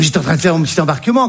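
ordinary talk

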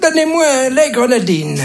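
put-on high, thin little-old-man voice; tiny old man. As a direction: squeak it like a frail old man. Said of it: "il y a un petit vieux qui arrive".

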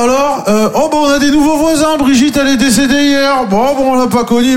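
high, put-on gossipy voice; mock old neighbour chatter. Scene future self on the balcony with his wife, high mock chatter.

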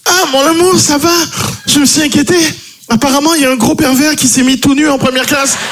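high falsetto wife; wife's voice. Acted. Groggy wife answers her husband in a high falsetto.

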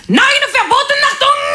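put-on barked, high German-accent commands; hammed-up German accent, shouted orders; mock German accent. Mother forbidding all music, in a shouted mock German accent. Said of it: "mon accent vosgien qui remonte".

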